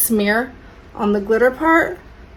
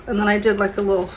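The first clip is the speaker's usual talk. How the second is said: normal talking voice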